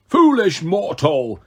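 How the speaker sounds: slow, booming monster scorn; bellowing giant. A narrator's reading. Cyclops bellowing at Percy on the cliff edge. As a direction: sneer it down at him. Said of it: "he bellowed".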